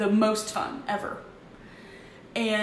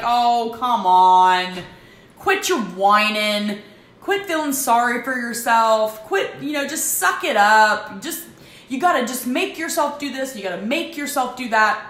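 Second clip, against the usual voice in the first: harsh scolding; 3 semitones higher, 9 dB louder than usual. Retold or imitated. Imagined critic scolding her for feeling sorry, loud and impatient. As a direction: snap at a weepy friend to suck it up. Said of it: "I'd be like".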